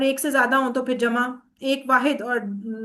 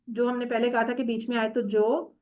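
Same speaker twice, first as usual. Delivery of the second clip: ordinary talk, nothing marked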